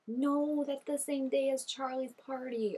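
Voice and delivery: high, drawn-out no of dismay; dismayed protest. Real, not acted. Viewer realising the date clashes with the party, dismayed. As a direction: wail that it can't be happening.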